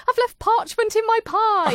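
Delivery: high, loud mock wail; Stacey. Host replaying a baker's parchment blunder, mock wail.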